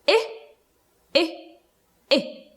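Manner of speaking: I, I, I as separate short "ih" sounds with pauses; short i drill. Shown as a demonstration. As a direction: model I as a short "ih" three times, spaced out. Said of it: "Let's try it together".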